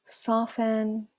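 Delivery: unhurried meditation voice with long pauses; guided meditation. Acted. Teacher guides online class to scan the body, calm meditation voice. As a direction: settle them gently into stillness, leaving space between phrases.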